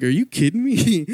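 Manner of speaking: mock disbelief. His reaction to rehab in Florida.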